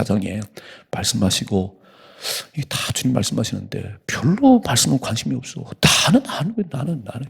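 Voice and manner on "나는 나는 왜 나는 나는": mocking me me. Mocking self-centered people.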